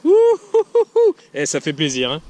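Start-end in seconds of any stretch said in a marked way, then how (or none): 0.0-1.1 high loud joyful whoops; excited whooping. Real, not acted. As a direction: hoot like you just won. Joie après un gros lemme. (cris de joie aigus)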